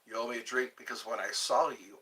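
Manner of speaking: ordinary talk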